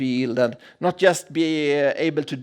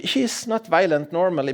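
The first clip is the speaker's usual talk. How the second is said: ordinary talk, nothing marked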